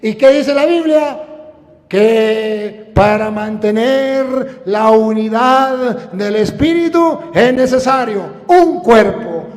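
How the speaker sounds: quick list building to ringing cries; fervent preaching. Acted. Preacher challenging rival sects' faith, fervent preaching. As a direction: rattle off the list, then proclaim loudly.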